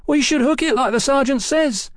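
high, pleading whine; timid crook siding with the sergeant; whining. A narrator's reading. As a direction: whine it well up in pitch. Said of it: "whined Bob Miles".

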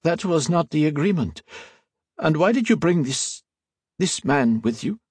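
a lightly voiced anxious archivist holding his price; haggling priest. A narrator's reading.

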